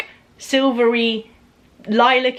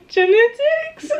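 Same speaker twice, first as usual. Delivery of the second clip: slow, high, giggling guess; laughing; slower than usual. Real, not acted. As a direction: laugh it out slowly. Laughing at Spanish question.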